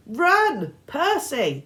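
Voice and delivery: high loud urgent shout. A narrator's reading. Girl shouting at Percy to run, loud. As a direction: yell at him to flee.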